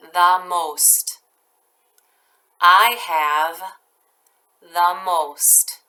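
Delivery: each chunk very slow with long pauses; teacher dictating a sentence to first graders, slowly; slow dictation. Shown as a demonstration. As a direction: dictate it piece by piece. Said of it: "I'll read each part very slowly".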